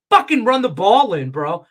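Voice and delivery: loud, high frustrated yell; angry outburst. Real, not acted. Frustrated bettor yelling at the quarterback, loud. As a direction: let it burst out. Ranting about taking points.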